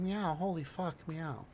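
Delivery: cat meow voice. Pretend. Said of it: "watching the videos and going".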